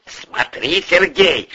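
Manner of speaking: menacing caution to a subordinate; ominous warning. A narrator's reading. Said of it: "зловеще предупредил из Швейцарии Порешайло".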